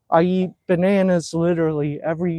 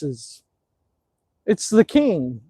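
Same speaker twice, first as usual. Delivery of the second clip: ordinary talk, nothing marked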